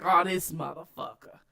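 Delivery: low, quiet sceptical aside; deadpan, low-pitched imitation of her thinking; imagined inner thoughts. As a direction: voice her private doubts low and under the voice. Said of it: "She like".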